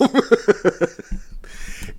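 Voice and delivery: breathy chuckle after a joke; amused, drawn-out chuckle; laugh. Real, not acted. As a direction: laugh at your own line. Laughs at own mock quote.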